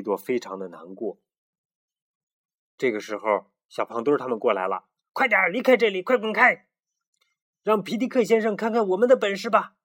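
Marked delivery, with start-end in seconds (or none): 5.1-9.8 much higher and louder, shoving them off; bullying shout. Acted. The bully roosters shout at the chicks to clear off. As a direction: yell at them to get lost. Bullies chase the chicks away.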